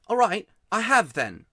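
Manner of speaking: put-on loud, miserable boy's outburst. A narrator's reading. Miserable boy admits crying to Polly, loud. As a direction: blurt it out loud, not caring who hears. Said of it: "in a much louder voice".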